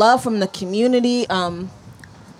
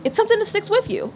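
tearing up; 6 semitones higher than usual. Real, not acted. Host describing [name]'s music, starting to cry. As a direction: voice climbing high as the tears come. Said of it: "here we go, it's starting".

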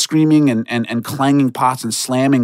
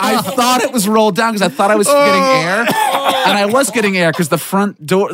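laughing; 9 semitones higher than usual. Real, not acted. Laughing through the telling.

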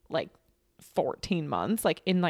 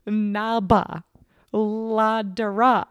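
put-on made-up baby word; 2 semitones higher, 6 dB louder, much slower than usual. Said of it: "taking random sounds and being like".

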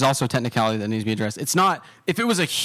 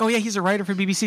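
higher, fast proud daughter; 8 semitones higher, much faster than usual. Retold or imitated. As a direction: brag it quickly and brightly. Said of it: "she's like".

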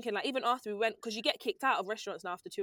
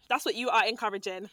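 ordinary talk, nothing marked

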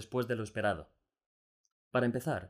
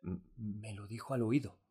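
calm Delacroix. A narrator's reading. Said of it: "respondió Delacroix con tranquilidad".

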